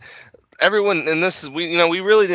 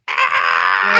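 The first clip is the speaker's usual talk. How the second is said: creepy cackle; 8 semitones lower, 4 dB louder than usual. Retold or imitated. Crypt Keeper cackle imitation.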